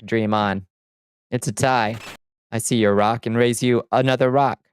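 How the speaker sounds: usual speaking voice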